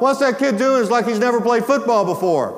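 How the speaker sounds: high-pitched indignant coach's cry; yelling coach. Retold or imitated. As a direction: imitate an outraged coach from the sideline. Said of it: "The visiting coach began to yell out".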